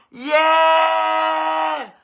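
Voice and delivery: long, high, loud yeah; cheering yeah. Real, not acted. Host cheers the cruise crew, loud held yeah. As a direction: hold it like a crowd cheer.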